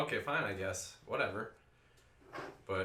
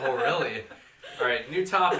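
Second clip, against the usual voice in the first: louder, laughing and flustered; 3 semitones higher, 9 dB louder than usual. Real, not acted. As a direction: laugh it off, change the subject. Embarrassed by partner's joke.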